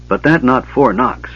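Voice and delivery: plain reading voice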